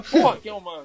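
laughing mock exclamation. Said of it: "Eu ia falar".

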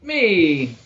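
high, loud showman voice; delighted stage performer; jovial performer. Acted. DM voices Octavio in character.

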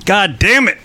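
sudden angry outburst, loud and high; explosive rage. Real, not acted. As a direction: blow up at the broken sound. Crackling sound ruins the show.

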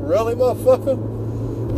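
mock-stunned grunt; lightly voiced henchman in disbelief; disbelieving soldier. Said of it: "The army does going".

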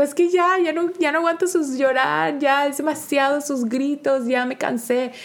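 high, whiny complaint through tears; mock whiny crying. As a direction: wail it like an exhausted mom at her limit. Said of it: "el bebé también llorando porque le digo". (queja llorosa exagerada, más aguda)

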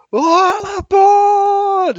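long, high, drawn-out shout; [name] yell. Pretend. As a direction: belt it out like a rock intro. Ozzy intro, calling out.

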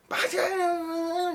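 high, laughing mimic of a scolding maid; imitating an annoyed housemaid, half laughing. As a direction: squeak out the maid's scolding through laughter. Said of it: "parang yung tono niya".

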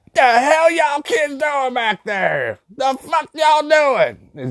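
high, drawn-out grandma yell that cuts through you; loud crackly old lady. Retold or imitated. Grandma yelling at the kids out back, loud crackly old lady voice. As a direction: screech it slowly like a cussing old grandma. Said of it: "old lady voices, just, like, loud and fucking crackly".

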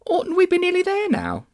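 put-on very high, light child's voice; little girl. A narrator's reading. Polly asking Diggory in the darkness, high girlish voice.

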